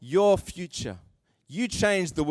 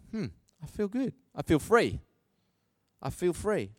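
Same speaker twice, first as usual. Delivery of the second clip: soft, satisfied sigh of relief; content and relieved; 5 dB quieter than usual. Pretend. Walking away after blessing someone, softly content. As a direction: feel light and free. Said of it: "you walk away going".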